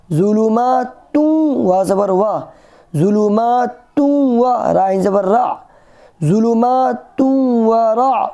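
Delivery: ज़ुलुमातुन व रा joined smoothly; tajweed joining. Shown as a demonstration. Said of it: "किस तरीके से मिलाएंगे".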